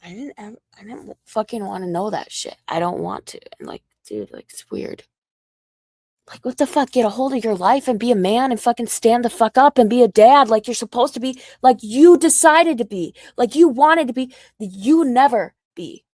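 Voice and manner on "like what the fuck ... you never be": louder, higher angry yelling; screaming at him to be a dad; furious shout. Real, not acted. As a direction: tear into him at full volume. Furious rant at ex.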